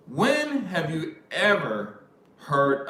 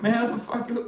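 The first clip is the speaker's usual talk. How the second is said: indignant retort; 11 semitones higher than usual. Retold or imitated. Swearing back at a complaining guy, indignant. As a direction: act out an exasperated comeback. Retold angry retort to friend.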